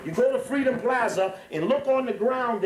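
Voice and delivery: usual speaking voice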